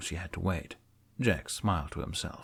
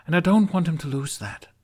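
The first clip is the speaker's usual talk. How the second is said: put-on higher, earnest young woman; Astrid; 11 semitones higher, 6 dB louder than usual. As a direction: search for the words, heartfelt.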